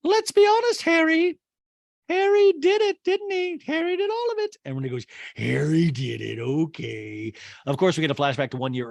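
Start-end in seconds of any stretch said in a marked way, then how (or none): 0.0-4.6 loud, extremely high mock insistence; squeaky caricature. Housewife pressing Harry's credit, loud squeaky insistence. 5.4-7.3 slow, drawn-out concession; drawled mimicked reply. Housewife conceding Harry did it, slow drawl.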